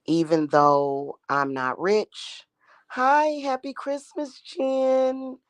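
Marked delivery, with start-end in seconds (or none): 2.9-5.4 high sing-song hello; delighted greeting. Real, not acted. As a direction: greet a friend in a much higher, drawn-out lilt. Greets a viewer joining the stream.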